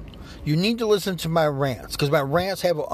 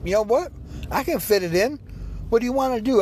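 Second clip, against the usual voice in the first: eager yes; 8 semitones higher than usual. Shown as a demonstration. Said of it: "your answer should be".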